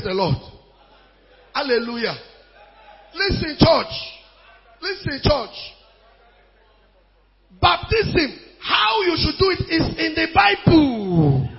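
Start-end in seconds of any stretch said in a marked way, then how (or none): 3.1-11.5 high, loud exhortation; ringing, emphatic preacher; full-force preaching. Real, not acted. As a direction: command the church's attention.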